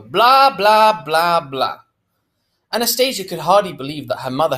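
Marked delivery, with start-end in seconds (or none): 0.0-1.8 singsong blahs, high then falling; mock a droning saleslady, starting high; mocking patter. A narrator's reading. Bored girl tunes out sales pitch, mocking singsong.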